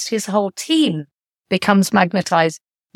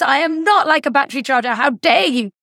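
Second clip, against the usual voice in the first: higher, fast mock-indignant protest; playing up a friend's fake outrage; imitating an angry retort; 6 semitones higher than usual. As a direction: retell his offended outburst, pitched up and quick. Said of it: "he got really angry".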